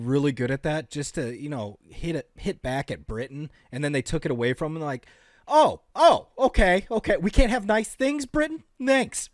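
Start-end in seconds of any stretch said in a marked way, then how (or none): 5.5-9.3 high, loud huffy retort; miffed, hammed-up comeback; mock indignation. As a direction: play at being wounded and sarcastic. Acting out miffed Australia at Britain.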